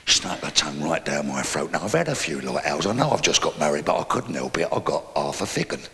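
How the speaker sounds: usual speaking voice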